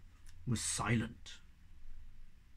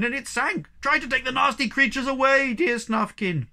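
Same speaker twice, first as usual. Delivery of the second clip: higher, louder desperate plea; moaning plea; 9 semitones higher, 6 dB louder than usual. A narrator's reading. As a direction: beg Snufkin from up the pole. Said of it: "moaned the poor Hemulin".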